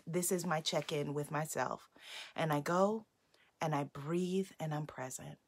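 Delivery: low, very soft and settled; softly, as if checking in with yourself; calm check-in. Shown as a demonstration. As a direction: drop right down into a quiet, centred moment. Voices a calm daily check-in.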